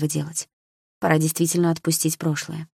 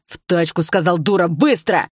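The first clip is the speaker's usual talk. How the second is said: louder harsh command, тачку hit hard; angry yell; 5 dB louder than usual. A narrator's reading. Ex repeats the order to the girl, angry yell. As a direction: bark it, growing louder.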